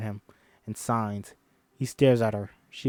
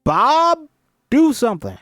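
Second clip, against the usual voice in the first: high, loud demand; teacher calling out a student; 13 semitones higher, 10 dB louder than usual. Acted. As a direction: call her out sharply. Bernardo calling on Ruby.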